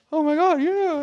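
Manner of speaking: squealed high and loud; mock gush. Friend gushing to coach, squealed high and loud. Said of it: "And you're like".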